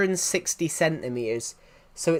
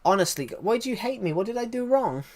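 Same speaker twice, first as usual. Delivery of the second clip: mock hurt; 7 semitones higher than usual.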